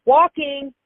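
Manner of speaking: sudden high, loud call; a quick shouted heads-up; retold yell. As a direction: call it out sharply like a warning down the hall. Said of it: "I would hear him yell in the phone".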